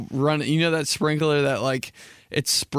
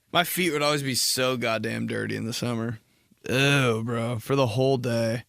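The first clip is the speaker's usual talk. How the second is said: normal talking voice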